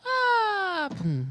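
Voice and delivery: "ah" held very high then dropped to a loud "pum"; falling glide. Shown as a demonstration. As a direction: swoop from the top down. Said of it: "essa inflexão". (inflexão aguda caindo em "pum")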